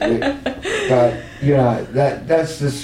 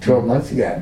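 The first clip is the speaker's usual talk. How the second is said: ordinary talk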